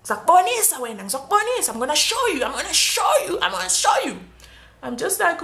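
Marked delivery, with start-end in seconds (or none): 0.0-4.2 raised, taunting imitation of the rude cop; lightly voiced menace; mock threatening cop. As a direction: rude cop vowing revenge, mildly mocked. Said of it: "the rude one was just like".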